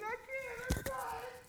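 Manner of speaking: put-on high, pleading little boy voice. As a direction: play the five-year-old begging his mama. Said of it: "And you may be saying".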